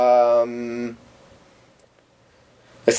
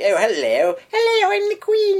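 very high, hammed-up Queen voice; exaggerated falsetto upper-class English accent. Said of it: "It's stereotyping the upper class".

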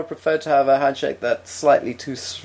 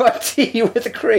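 high, slow shocked question; incredulous; 8 semitones higher, slower than usual. Retold or imitated. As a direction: ask it aghast. S1 voices incredulous reply.

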